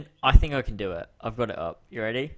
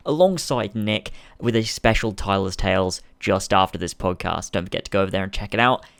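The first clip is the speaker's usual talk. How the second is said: brisk, loud ad read; podcast outro read; 6 dB louder, faster than usual. Acted.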